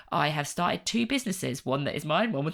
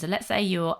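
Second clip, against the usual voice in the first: ordinary talk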